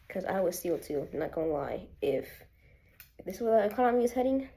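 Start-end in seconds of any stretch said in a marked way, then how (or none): 2.0-4.5 fast and louder, worked up; heated rant. Real, not acted. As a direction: rattle it off, exasperated. Ranting about grocery prices.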